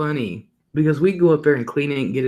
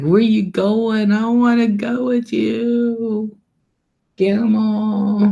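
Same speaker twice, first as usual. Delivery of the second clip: put-on high, slow little-kid voice; grandkids whining; 9 semitones higher, 3 dB louder, slower than usual. Grandkids begging to come along, high and slow. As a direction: plead like a small grandchild. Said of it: "So they're like".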